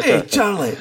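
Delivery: high, playful Scottish call; high sing-out greeting; hailing little Charlie. Retold or imitated. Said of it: "talking in your Scottish accent to Charlie".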